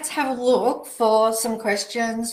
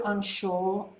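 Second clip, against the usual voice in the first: ordinary talk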